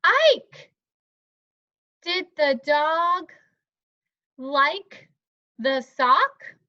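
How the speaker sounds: high, loud, halting pupil reading; student reading aloud. Pretend. Plays student misreading the question.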